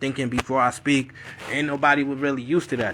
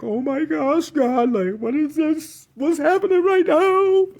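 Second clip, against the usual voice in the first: high, squealing mock whimper; holding back tears; 12 semitones higher, 4 dB louder, slower than usual. Son listening in disbelief, high tearful whine. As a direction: reenact fighting tears, pitch climbing to a squeak. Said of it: "I'm trying not to start crying".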